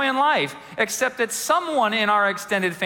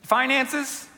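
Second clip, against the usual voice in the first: usual speaking voice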